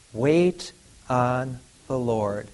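very slow, stretched words; drawn out. Acted. Preacher to congregation, slow and drawn out. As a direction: stretch every word out.

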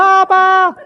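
high, loud third call of Papa; grandkid's loudest call to grandpa; insistent call. Retold or imitated. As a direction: really call him now. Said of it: "if I'm still not paying attention".

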